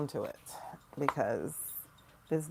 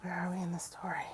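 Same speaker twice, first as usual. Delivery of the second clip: soft, fast whisper; whispered question; 6 dB quieter, faster than usual. Real, not acted. Asking the tarot deck where we are, whispered. Asks cards quietly.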